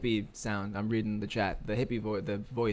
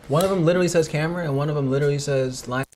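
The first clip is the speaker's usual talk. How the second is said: loud, higher call across the room; 5 semitones higher, 12 dB louder than usual. Real, not acted. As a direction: shout the instructions over. Calling instructions across room.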